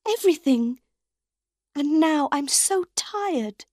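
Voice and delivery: tired, hurt soprano pleading with her unseen lover; unhappy and afraid. A narrator's reading. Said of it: "Her voice was unhappy and afraid".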